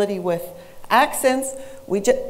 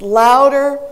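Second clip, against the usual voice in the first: LOUDER said loudly, demonstrating itself; 3 semitones higher, 9 dB louder than usual. Shown as a demonstration. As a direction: punch the word "louder" with extra volume. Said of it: "the center syllable was pronounced".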